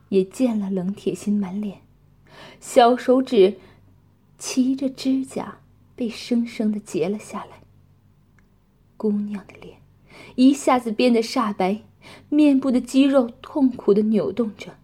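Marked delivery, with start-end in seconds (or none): none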